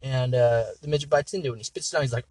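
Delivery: usual speaking voice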